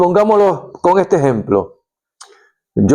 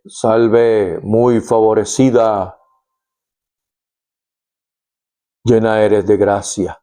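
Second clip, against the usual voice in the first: lower, expressionless greeting like a bouncer; flat bouncer voice; 5 semitones lower than usual. Retold or imitated. Angel greeting Mary as a bored bouncer, flat and lower. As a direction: say it deadpan, like a guard at a door. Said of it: "así como, como un bouncer, como un guardaespaldas".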